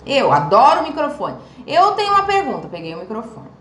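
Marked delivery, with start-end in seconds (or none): 1.7-2.7 high, bright call for the mic; eager question. Retold or imitated. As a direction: jump in eager to ask. (pergunta animada em voz alta)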